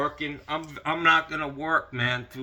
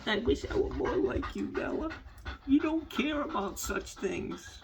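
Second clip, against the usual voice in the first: high, softer pet voice; voicing the dog; 5 dB quieter than usual. Owner speaking to his dog Bella in a high, soft voice. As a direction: say it small and wistful in the dog voice.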